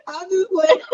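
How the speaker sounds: high, giggly and cracking up; helpless laughter at your own joke; laughing. Real, not acted. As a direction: squeeze the words out through laughter.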